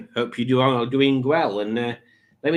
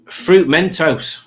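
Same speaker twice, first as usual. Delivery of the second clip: slow drawn-out announcement; 4 semitones higher, 6 dB louder, much slower than usual. Acted. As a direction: stretch it out, louder. Drawn out reading of chat.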